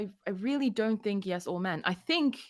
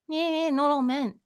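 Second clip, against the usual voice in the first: mocking; 8 semitones higher than usual. Mocking men who say it.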